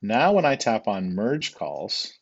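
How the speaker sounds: normal talking voice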